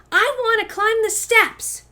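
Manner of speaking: high, loud insistent cry; determined plea. Acted. Girl telling grown-ups she will climb, high and insistent. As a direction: plead to be let up the steps. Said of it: "she says".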